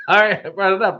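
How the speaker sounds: high, laughing through the words; laughing apology. Real, not acted. Laughs while apologising for allergic reaction.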